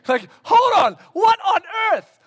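high, loud disbelief; shocked high outburst; mock surprise. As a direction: act baffled. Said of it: "feigned shock".

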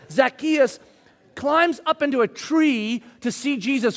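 loud, high full-force sermon; fiery preaching. Acted. Preacher urging the congregation, full-force preaching. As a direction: preach it to the back row.

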